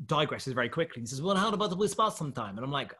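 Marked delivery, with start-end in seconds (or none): 1.2-2.6 lightly put-on Russian accent, a bit higher; a touch of mock Russian accent. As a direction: imitate a Russian challenger. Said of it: "this Russian guy comes in".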